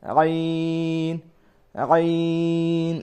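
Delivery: আইন drawn very long from mid-throat; throat letter. Shown as a demonstration. Teacher demonstrating আইন from the throat. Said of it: "হলকের মধ্যে আইন".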